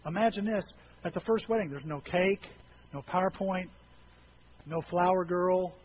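ordinary talk, nothing marked

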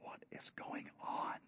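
unvoiced whisper. Disciples whispering as Jesus washes feet. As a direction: whisper it in awe. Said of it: "And they're all going".

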